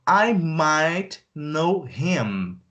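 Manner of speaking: stretched, careful read; slow teaching voice; very slow model sentence. Shown as a demonstration. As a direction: stretch every word. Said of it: "slow model take".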